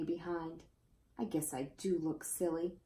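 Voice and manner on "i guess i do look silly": softly, a dejected pony; sad and quiet. A narrator's reading. Pony sadly admits he looks silly, said quietly. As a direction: hang your head as you say it.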